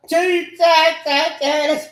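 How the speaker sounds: slow, high, loud chant-like shout; shouted outburst. Real, not acted. Speaker bursting out mid-rant, shouting slowly. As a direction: draw the question out at a yell.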